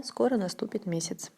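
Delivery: ordinary delivery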